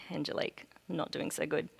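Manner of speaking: whispered, breathless and soft; hushed confession. Retold or imitated. Telling her friend on the phone, whispered worry. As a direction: confide it under her breath.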